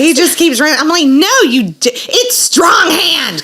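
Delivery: high, loud exasperated correction; Strong Hand stretched out slowly and shouted; passionately hammering the right nickname; emphatic retold insistence. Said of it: "I was passionate, very emphatic".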